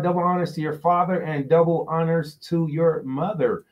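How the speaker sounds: normal talking voice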